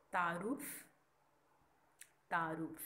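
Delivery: तारुफ with a long open taa; careful pronunciation. Shown as a demonstration. Teacher models the zabar vowel for students. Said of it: "ता के ऊपर ... ज़बर है".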